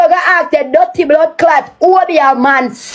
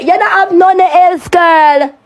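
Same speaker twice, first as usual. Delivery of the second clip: ordinary talk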